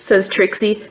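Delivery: ordinary delivery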